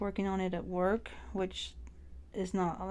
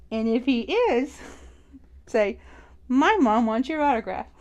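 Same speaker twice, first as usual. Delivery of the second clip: excited louder instructions; 2 semitones higher, 6 dB louder than usual. Retold or imitated. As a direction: hype it up like an eager fan mom. Mom coaching daughter, getting louder.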